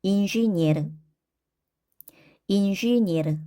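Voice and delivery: 인슈니에르 with e before the stress reduced; unstressed e as i. Shown as a demonstration. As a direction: say 인슈니에르, stress on the last vowel. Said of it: "야는 이로 발음을 한다".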